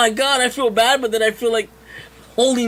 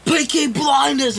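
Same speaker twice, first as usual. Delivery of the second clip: slow, louder mock British shout of "Peaky Blinders!"; hollering "Peaky Blinders!" in a shitty British accent, higher up; 7 semitones higher, 4 dB louder, slower than usual. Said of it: "in his, like, fucking British accent".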